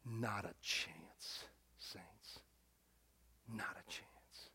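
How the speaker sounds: very low, slow and hushed; grave, whispered certainty; near whisper. Real, not acted. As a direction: let it sink almost to a whisper. Preacher underlines death's helplessness.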